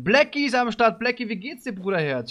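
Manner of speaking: high, delighted hello to a buddy; excited greeting. Real, not acted. Greets a viewer who just arrived. (freudig aufgedrehte Begrüßung)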